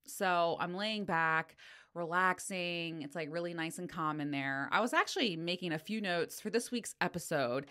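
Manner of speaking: ordinary talk, nothing marked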